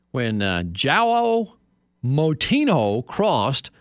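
dramatic read, pitched high with drawn-out names; flowery sportswriting read. Acted. Host reading flowery World Cup copy to his co-host, theatrically. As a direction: savor each phrase grandly, stretching the key words. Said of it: "Don't you like the flowery writing here".

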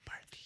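Barth whispered with a th ending. Shown as a demonstration. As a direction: breathe Barth with a lisp. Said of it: "It's almost like a lisp".

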